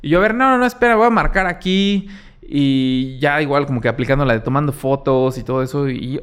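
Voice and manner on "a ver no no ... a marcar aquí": insistent, don't let him go; blocking the nervous driver while dialing; urgent stop. Retold or imitated. Stopping the driver, calling support.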